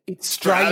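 "Australia" as "Straya", L dropped. Shown as a demonstration. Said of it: "there's no L".

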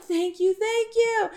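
high-pitched gushing thanks; hammed-up grateful squeal; mock gratitude. Imitating captives thanking their supposed rescuer.

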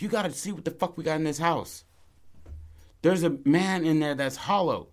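high-pitched, urgent exclamation; acted urgent alarm. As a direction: burst out with shocking news. Characters rush out with news.

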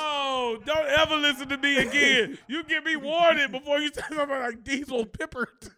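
loud, high protest with laughter; worked-up, laughing telling-off; mock scolding. Real, not acted. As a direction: scold your co-host while cracking up. Mock scolding co-host through laughter.

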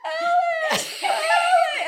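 high, loud yelps through laughter; acted panicked cries. Mother left behind running in wedges, high mock-panicked yelps. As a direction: yelp comically as if struggling along.

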